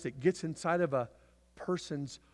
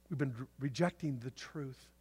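normal talking voice

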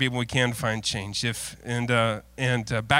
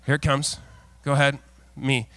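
ordinary talk

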